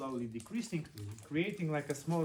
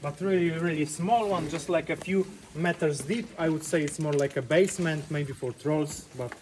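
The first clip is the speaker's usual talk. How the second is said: tour guide projecting; 3 semitones higher, 16 dB louder than usual. Real, not acted. Tour guide addressing group loudly.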